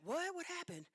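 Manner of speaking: high, urgent alarmed questions; high-pitched, startled and worried. Retold or imitated. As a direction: fire off the questions in a high panic. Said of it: "So now I'm alarmed".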